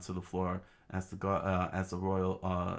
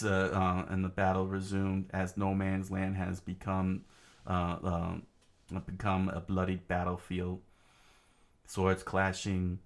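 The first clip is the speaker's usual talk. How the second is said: plain reading voice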